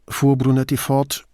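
ordinary delivery

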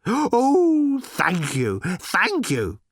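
high, loud swooning gratitude; ecstatic robot. A narrator's reading. Robot thrilled at being shouted at, ecstatic. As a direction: gasp out thanks in rapture.

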